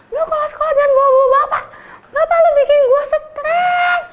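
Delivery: high, fast laughing yell; laughing threat. Retold or imitated. Senior telling the teacher's son off, high laughing yell. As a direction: threaten him, cracking up.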